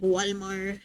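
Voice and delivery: imitating a parent's accented Walmart; parents' accent. Quoting how parents say Walmart, parents' accent. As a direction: say it low, in a parent's Spanish accent. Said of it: "when your parents say".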